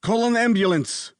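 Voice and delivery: high, urgent order; father orders the nanny to call an ambulance; commanding. A narrator's reading. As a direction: bark the order to get help now. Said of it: "Mister Mitchell commanded".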